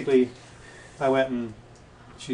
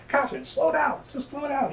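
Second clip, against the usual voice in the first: exasperated plea; 14 semitones higher than usual. Retold or imitated. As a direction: beg her to ease off, pitched way up. Pleading with Catherine inside him.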